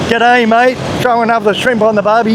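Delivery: lightly put-on fake Australian accent; a hint of tourist's mock-Aussie accent; American faking Aussie. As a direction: mimic an American attempting Aussie slang. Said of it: "like an American coming to Australia".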